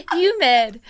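"Humid" comes out as "yumid"; dropped H. Shown as a demonstration. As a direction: leave the H off "Humid". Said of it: "let's hear you try".

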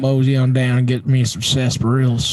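hammed-up Texas twang; Texas accent. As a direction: play the moseying Texan. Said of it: "Let me hear your Texas accent".

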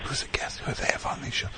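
unvoiced whispered aside; stage whisper. Real, not acted. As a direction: whisper it off-mic to yourself. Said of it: "aside whispered mid-bit".

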